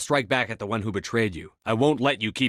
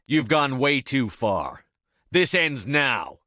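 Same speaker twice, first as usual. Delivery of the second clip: slow, deliberate final warning; slow grim threat; 4 semitones higher, much slower than usual. Acted. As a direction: spell out each word like a verdict. Final threat before a fight.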